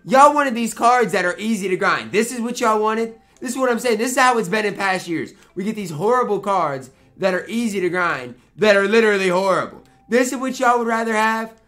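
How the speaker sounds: real frustrated outburst; mad rant. Real, not acted. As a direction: vent your anger loudly at a bad game card. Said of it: "I'm just mad right now".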